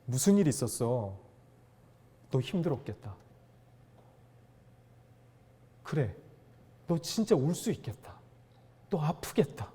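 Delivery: sit beside them and speak softly; softly showing how to comfort someone who cries; gentle comfort. Shown as a demonstration. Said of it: "그냥 이렇게 이야기해주세요".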